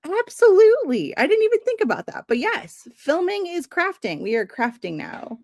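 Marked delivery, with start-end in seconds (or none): 0.1-4.2 excited. Real, not acted. Delighted by a viewer's comment.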